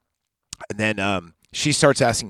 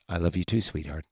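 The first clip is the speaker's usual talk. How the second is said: creepy; 5 semitones lower, 9 dB quieter than usual. Retold or imitated. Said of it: "really creepy scene where he goes".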